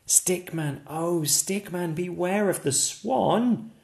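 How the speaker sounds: high, sing-song warning call. Acted. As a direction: chant it like a friendly warning. Chanted warning refrain.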